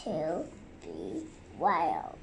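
low, pressed, slow voice. Real, not acted. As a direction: voice squeezed down low. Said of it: "being pressed really, really low".

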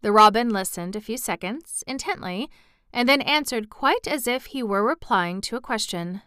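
usual speaking voice

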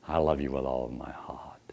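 low, soft whispered endearment; romantic whisper. Shown as a demonstration. A man to a woman, a low romantic whisper. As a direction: whisper it like a lover.

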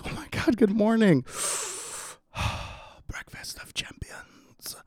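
hushed awe. Retold or imitated. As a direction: savour it in a breathy whisper. Stoned morning discovery of the vape.

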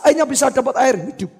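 ordinary talk

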